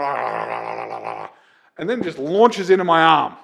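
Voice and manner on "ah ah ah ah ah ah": low imitated panting; imitating the dog panting, low. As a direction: pant low like an eager dog. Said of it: "dribbling all over me, going".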